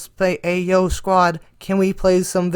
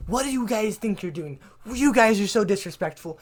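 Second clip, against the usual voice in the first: mock angry teacher; 5 semitones higher than usual. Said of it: "She's like".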